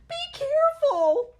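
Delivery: slow piercing high shriek. A narrator's reading. Mother shrieking as powder spills, very high and loud. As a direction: screech a warning. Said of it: "shrieked the mother".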